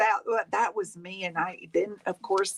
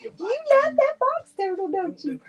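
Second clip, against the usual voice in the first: very high doting coo; 10 semitones higher than usual. Real, not acted. Baby talk asking kisses.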